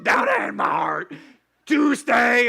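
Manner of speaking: loud, deep, growly singing; a sour teacher belting a joy song. Retold or imitated. Teacher leads the Bible class song, deep and growly. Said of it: "she'd go".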